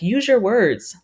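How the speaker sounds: hammed-up frustrated parent line; mock parent. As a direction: snap it like a fed-up parent. Mimicking frustrated parents' stock phrase.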